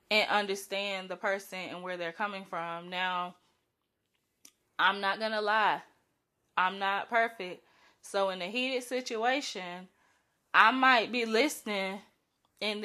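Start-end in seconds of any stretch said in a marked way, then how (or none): none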